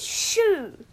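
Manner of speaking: high, loud storybook shoo; shooing away. A narrator's reading. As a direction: shoo them off sharply. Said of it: "all the queue told Lou".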